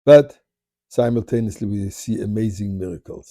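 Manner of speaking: plain reading voice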